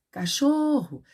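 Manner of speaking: high-pitched call, stressed syllable held; calling out loud. Shown as a demonstration. Teacher showing pupils how it works, calling out loud. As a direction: call the word out as if summoning the dog. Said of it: "A tia tá falando em voz alta".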